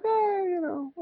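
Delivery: high, soft, reluctant "eh"; dismissive. Retold or imitated. Recalling his own doubts about the class, dismissive. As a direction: wave it off in a small high voice. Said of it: "I kinda dismissed it".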